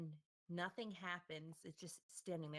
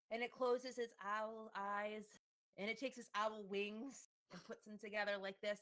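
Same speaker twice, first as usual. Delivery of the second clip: normal talking voice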